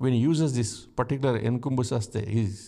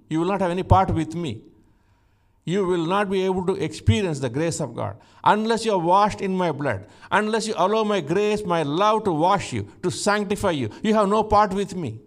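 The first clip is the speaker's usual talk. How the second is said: louder, higher, driving sermon climax; preaching at full force; 5 semitones higher, 4 dB louder than usual. Acted. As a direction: preach it hard to the congregation.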